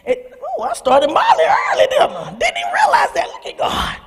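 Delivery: high-pitched laughter through the words; laughing. Real, not acted. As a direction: laugh at your own slip, squealing. Laughing at her own slip.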